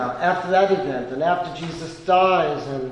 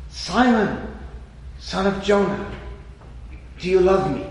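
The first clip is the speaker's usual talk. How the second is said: raised, pleading call to Simon; earnest question; 4 semitones higher, 3 dB louder than usual. Acted. Jesus asking Peter, loud and pleading. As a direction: lift it high and press the question.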